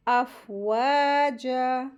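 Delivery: अफ़वाजा with both long a vowels held; stretched madd. Shown as a demonstration. Teacher models madd at a stop, अफ़वाजा slowly stretched. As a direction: draw out waa and jaa in अफ़वाजा. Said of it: "इसको दो हरकत खींचेंगे".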